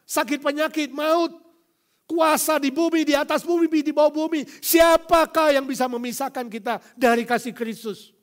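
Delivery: loud, high preaching climax; rising fiery sermon; preaching at full force. Acted. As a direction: thunder it from the pulpit.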